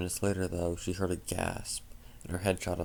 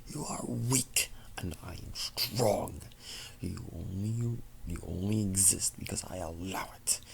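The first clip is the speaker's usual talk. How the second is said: cold taunting whisper; shadow whisper. Acted. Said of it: "The voice began to laugh".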